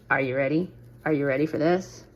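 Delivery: low, soft, coaxing teaser; teasing hush. Real, not acted. Crafter teasing viewers before the reveal, hushed. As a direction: build suspense in a hush.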